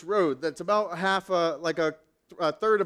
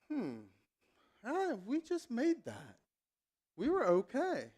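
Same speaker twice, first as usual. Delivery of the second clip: softer, relaxed reassurance; calm and settled; 3 semitones higher, 10 dB quieter than usual. Retold or imitated. Passenger who starts trusting the driver, soft and calm.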